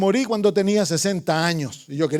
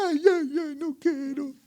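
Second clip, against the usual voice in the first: put-on high whimpering child refusing bed; whiny sick child; 8 semitones higher than usual. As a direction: whine like a feverish little boy. (niño enfermo lloriqueando que no quiere ir a la cama)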